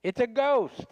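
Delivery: high-pitched mock cry of alarm; imitated yell. Man on the boat spotting Jesus on the water, imitated yell. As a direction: call it out high, as a frightened boatman might. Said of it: "somebody on that boat yelled out".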